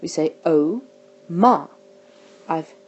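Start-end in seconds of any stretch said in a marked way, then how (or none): 0.5-1.7 "Oh, ma" as two separate syllables with a clear pause; broken into syllables. Shown as a demonstration. Teacher shows learners the syllables of oma, split by a pause. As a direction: split "Oh, ma" slowly apart at the syllable break. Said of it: "where it gets broken up".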